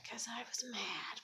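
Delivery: hushed whispered admission. Real, not acted. Confessing to the listener why she smashed her phone, whispered.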